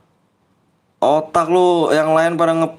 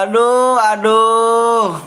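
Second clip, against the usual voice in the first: high hammed-up "aduh" wail; mock pained groan; 7 semitones higher, much slower than usual. Gamer hamming up getting hit, mock wail. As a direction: overdo the pain cry. Exaggerated pain cry in game.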